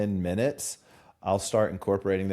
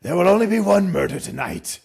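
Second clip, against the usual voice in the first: loud, raised menacing villain voice; 10 semitones higher, 8 dB louder than usual. Acted. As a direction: declare it as a threat, high and loud.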